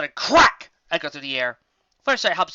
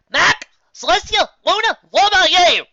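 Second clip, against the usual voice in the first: high, loud calls of names; shouting; 12 dB louder than usual. Acted. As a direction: yell for each of them.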